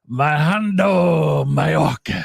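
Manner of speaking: Alejandro Mayorkas shouted slowly in Spanish accent; loud Spanish accent. Shown as a demonstration. Teasing a friend, shouting the name in a Spanish accent. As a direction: belt Alejandro Mayorkas with a big Spanish flair. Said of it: "That's how I say it".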